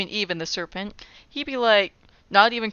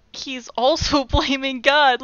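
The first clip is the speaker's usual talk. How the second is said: ordinary talk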